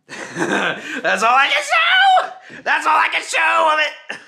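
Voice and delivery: high, loud and laughing; laughing shout. Real, not acted. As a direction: burst out laughing as you yell.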